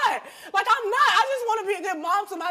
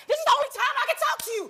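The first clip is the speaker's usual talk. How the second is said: angry shout; 7 semitones higher, faster than usual. Real, not acted. Daughter yelling at her mother that this is her only chance, fast angry shout. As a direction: blurt it at her in a rush, yelling.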